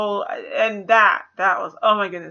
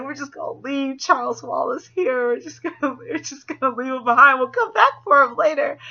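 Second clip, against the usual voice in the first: higher, laughing mock of a careless father; mocking the dad; 5 semitones higher, 3 dB louder than usual. Mocking the father who abandons his son, laughing. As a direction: sneer it through a laugh. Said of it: "he's like".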